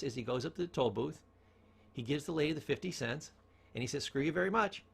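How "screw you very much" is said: beaming, friendly delivery; cheery fake thanks. Cousin at the toll booth, cheerfully friendly. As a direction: say it like greeting your best friend. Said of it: "said it in such a way with a big smile".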